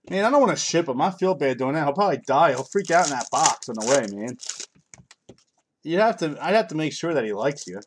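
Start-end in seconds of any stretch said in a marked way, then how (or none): none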